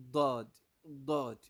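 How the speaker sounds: "Dod" with tongue sides against the teeth; teacher models emphatic "Dod"; Arabic Daad demo. Shown as a demonstration. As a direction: punch "Dod" loudly with a heavy DH-like D. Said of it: "The side of your teeth".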